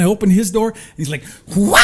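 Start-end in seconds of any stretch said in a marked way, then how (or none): 1.6-1.8 put-on a short, loud baby-like wail; imitated toddler wail. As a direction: wail like a scared two-year-old. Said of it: "like that".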